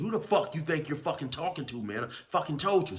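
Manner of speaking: menacing challenge. Retold or imitated. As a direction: threaten him before shoving. Big dude threatens the young dude.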